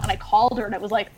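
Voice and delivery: ordinary talk, nothing marked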